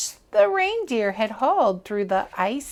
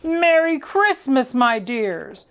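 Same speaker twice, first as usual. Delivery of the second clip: louder, jolly farewell cry; delighted Santa; 3 dB louder than usual. Acted. Santa calling goodnight from the sleigh, jolly and loud. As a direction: wave goodbye with a cheery cry. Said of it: "with a cry of delight".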